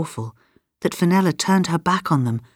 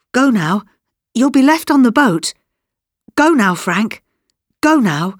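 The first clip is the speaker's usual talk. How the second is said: raised, urgent pleading; agitated; 6 semitones higher, 3 dB louder than usual. A narrator's reading. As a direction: hurry him away, pitch jumping up. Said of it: "Grandma was very agitated and answered".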